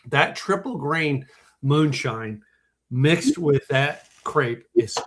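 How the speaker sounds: slow and relishing each word; slow savouring. Real, not acted. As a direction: linger over it like a treat. Savouring the moonshine pairing.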